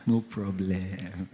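slow, low, softened resignation; understated, simmering "fine then"; mock acceptance. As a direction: draw it out quietly, holding back a threat. Swallowed reaction to being refused boarding.

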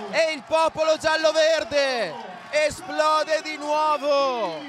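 goal celebration shout. Real, not acted. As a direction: scream the scorer's name in joy. Goal scored, commentator erupts.

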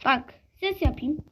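ordinary talk, nothing marked

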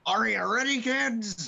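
high, loud sea captain shout; cartoon captain call. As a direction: bellow like a pirate captain. SpongeBob intro call.

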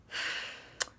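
long weary exhale; exasperated sigh. Retold or imitated. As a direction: sigh before explaining it all. Exasperated before explaining continents.

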